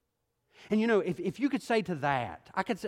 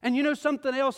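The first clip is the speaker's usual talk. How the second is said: high, loud preacher's build, driving hard; rising sermon fervor, loud and high; full-force preaching; 8 semitones higher, 6 dB louder than usual. Acted. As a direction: preach it to the whole room, pressing.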